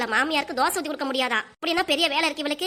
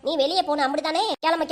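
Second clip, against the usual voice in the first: normal talking voice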